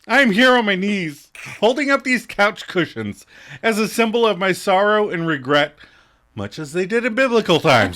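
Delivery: high, loud theatrical declaiming; grandiose mock apology. Acting out a sitcom apology to a friend, grandiose mock declaiming. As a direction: plead it big and hammed-up, like an oration.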